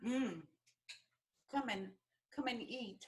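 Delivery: soft, murmured while eating; soft savouring murmur. Real, not acted. Guest savours the chicken, softly inviting host to eat.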